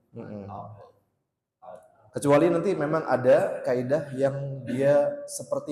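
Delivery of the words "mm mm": usual speaking voice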